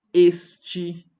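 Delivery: "Este" punched high and loud; emphatic este. Shown as a demonstration. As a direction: hit "Este" hard as the example word. Teacher stresses the demonstrative. ("Este" bem acentuado, alto e forte)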